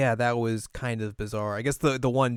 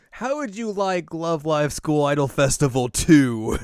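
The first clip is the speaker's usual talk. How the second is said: slow, louder mock announcer; 4 semitones higher, 7 dB louder than usual. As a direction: pitch it like an ad.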